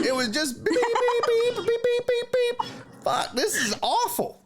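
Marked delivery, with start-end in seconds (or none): 0.0-2.7 high, rapid beep beep beep like a censor tone; Morse code beeps. Shown as a demonstration. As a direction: beep out the curses like a censor bleep. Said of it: "sound like I was talking in Morse code".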